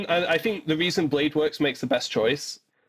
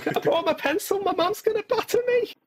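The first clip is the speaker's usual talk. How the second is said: put-on very high Scouse kid; hammed-up squeaky Scouse panic; Scouse accent. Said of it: "That's a good Scottish accent".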